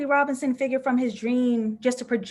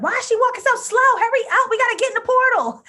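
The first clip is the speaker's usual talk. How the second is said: impatient Twitter viewers; 13 semitones higher, 7 dB louder, faster than usual. Retold or imitated. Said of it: "people on Twitter going off like".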